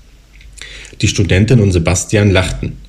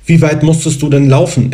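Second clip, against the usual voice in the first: suddenly high voice; 6 semitones higher than usual. A narrator's reading. Sebastian asks Lena in a suddenly higher voice. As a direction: voice jumps higher to impress her. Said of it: "wie hoch dessen Stimme plötzlich klang".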